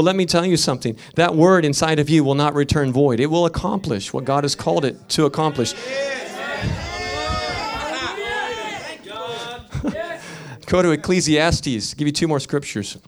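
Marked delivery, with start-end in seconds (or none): 5.8-10.1 laugh. Real, not acted. Preacher laughs mid-sermon.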